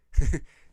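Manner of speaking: short loud burst of laughter. Real, not acted. Streamer laughing at childhood photo, loud burst.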